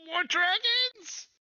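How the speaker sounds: slow, very high squeal; hammed-up small goblin dread; alarmed goblin. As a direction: stretch out a loud, squeaky question in dismay. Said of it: "He says".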